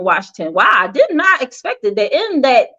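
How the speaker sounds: ordinary delivery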